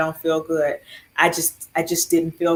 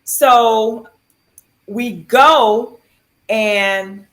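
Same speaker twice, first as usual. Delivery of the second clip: ordinary delivery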